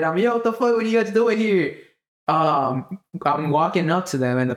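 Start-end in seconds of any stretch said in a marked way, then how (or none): 0.2-1.8 high-pitched excited surprise; all excited. Retold or imitated. Running into podcast homies, all excited. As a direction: act thrilled to run into them. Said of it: "I'm, like, all excited".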